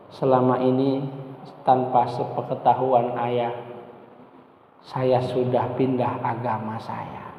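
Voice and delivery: low, slow, heavy admission; contrite confession. Retold or imitated. Daughter confessing her conversion to father, contrite.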